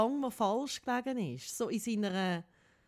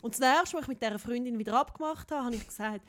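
ordinary talk, nothing marked

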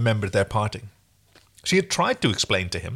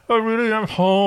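high, loud diva imitation; mock [name], louder and higher; [name] parody; 10 semitones higher, 4 dB louder than usual. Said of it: "with the Aaron voice ... a parody of [name]".

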